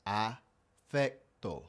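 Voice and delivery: slow, syllable by syllable; slow, careful. Shown as a demonstration. Teacher modelling the word slowly for learners to repeat. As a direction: land each syllable slowly. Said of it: "I'll pronounce them correctly".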